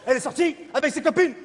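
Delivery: usual speaking voice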